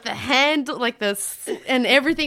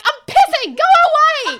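high, louder laughing yell; 14 semitones higher, 6 dB louder than usual. Retold or imitated. Peeing woman yells at gawking drunk, high laughing shout. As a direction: shoo them off with a cry. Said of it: "And I'm like".

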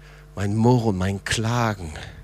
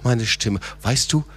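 fervent preaching; 9 semitones higher, 4 dB louder than usual. Acted. Pastor urging the church to cry to the Lord, fervent preaching. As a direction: preach it, calling them out of the pit. Preacher rising to full force.

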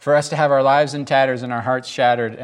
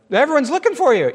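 urgent disciples; 11 semitones higher, 4 dB louder, faster than usual. A narrator's reading. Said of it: "they finally found him".

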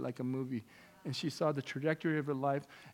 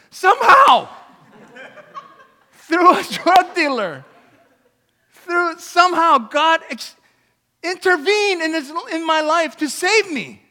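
loud, high, emphatic proclamation; impassioned preaching; 13 semitones higher, 17 dB louder, slower than usual. Real, not acted. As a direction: punch out each phrase slowly and forcefully. Preaching at full force.

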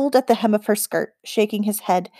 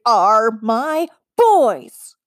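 slow, drawn-out loud growl; growling loudly; 2 semitones higher, 4 dB louder, much slower than usual. A narrator's reading. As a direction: growl it out, furious mother. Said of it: "she growled loudly".

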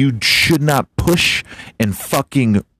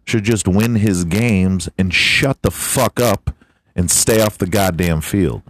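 ordinary talk, nothing marked

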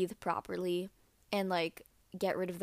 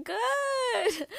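much higher fake-cheerful good; to mom, thumbs up, fake cheerful high; 14 semitones higher than usual. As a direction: sell the lie with a squeak. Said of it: "I was like".

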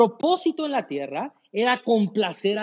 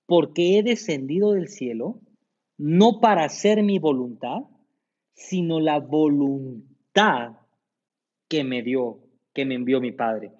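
reading scripture; 6 semitones lower than usual. Acted. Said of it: "Jesús. Juan seis treinta y ocho".

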